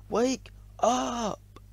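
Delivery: very high, drawn-out call; shouted wake-up call. A narrator's reading. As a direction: stretch both words out high to rouse a sleeper. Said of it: "and shouted".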